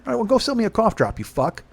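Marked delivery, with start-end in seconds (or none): none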